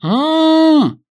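loud high muffled shout through gag; desperate gagged scream. A narrator's reading. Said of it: "Дэн отчаянно мотал головой".

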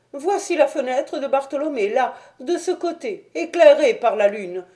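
high-pitched, anxious gentleman; high voice of a worried little man; Thaddeus, uneasy. Acted. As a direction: explain in a high, troubled voice.

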